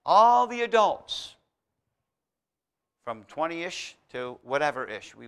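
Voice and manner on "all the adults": higher, louder, slower "all the adults"; raised preaching voice. Real, not acted. Preacher pressing his church to grow up, raised voice. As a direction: press the point up high.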